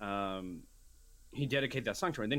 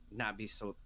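normal talking voice

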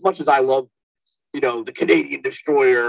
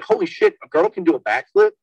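amazed; 7 semitones higher than usual. Retold or imitated.

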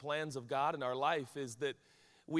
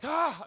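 sharp higher, louder "Ah" of exasperation; frustrated groan; 11 semitones higher, 7 dB louder than usual. Retold or imitated. Higher, louder exasperated "Ah" over a lost parking spot. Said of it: "the littlest thing can just frustrate me".

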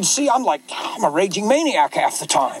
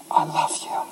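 unvoiced tender whisper; whispered I love you; 4 dB quieter than usual. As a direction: hush it right into her ear. Husband reassures wife.

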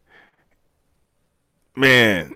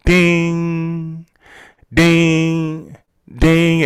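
high, ringing imitation of a timer bell; timer ding; 6 semitones higher, much slower than usual. Imitating the meditation timer going off, ringing dings. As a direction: hold each ding long on one high note. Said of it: "And the timer went off".

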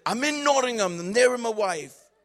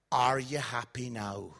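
deadpan question; 9 semitones lower, 4 dB quieter than usual. Retold or imitated. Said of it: "and he went".

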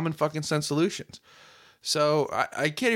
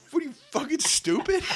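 incredulous high outburst; 13 semitones higher than usual. Real, not acted. Outraged rhetorical jab.

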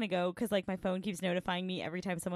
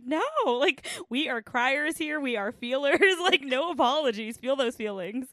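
reassuring; 7 semitones higher, 8 dB louder than usual. Retold or imitated. Reassuring an emotional participant.

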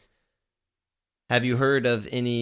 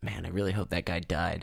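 muttered aside, much softer; under his breath; 3 semitones lower, 7 dB quieter than usual. Acted. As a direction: mutter it so only you hear. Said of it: "and then under my breath".

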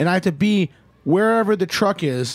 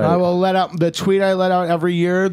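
ordinary talk, nothing marked

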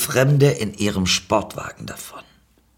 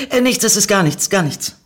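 flustered, high-pitched denial; stammering; 9 semitones higher, 4 dB louder than usual. A narrator's reading. Narrator answers the attractive stranger back, flustered stammering. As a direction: stumble over yourself, voice pitched up. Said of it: "stotterte ich zurück".

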